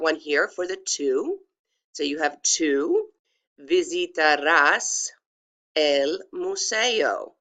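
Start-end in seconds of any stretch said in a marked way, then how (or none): 2.4-7.3 slow, drawn-out Spanish, stress on the "ás" of "visitarás"; pronunciation demo. Shown as a demonstration. Teacher modelling the tú sentence, slow careful demo. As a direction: sound out each letter of "tú visitarás el museo". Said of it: "I pronounced each letter".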